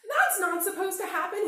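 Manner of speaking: high, loud shocked protest; dismayed. A narrator's reading. Bear cub watching his hat ruined, high and loud. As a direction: cry out in disbelief. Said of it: "he said".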